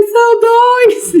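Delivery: grito agudo, alto e arrastado de espanto; grito de choque. Retold or imitated. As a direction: solta a notícia num berro lento e muito agudo. Ela conta a notícia chocada. (anunciando aos colegas, grito agudo e lento)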